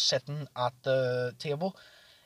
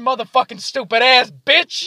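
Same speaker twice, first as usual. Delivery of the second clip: loud, high, drawn-out insult; imitated roast; 8 semitones higher, 10 dB louder than usual. Friend capping off a drunken roast, mock-aggressive. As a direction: hammer out a slow mock insult. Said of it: "start roasting each other".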